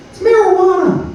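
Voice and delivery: put-on high, bright eager child answering; eager kid voice. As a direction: shout it out like a know-it-all kid. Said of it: "he goes".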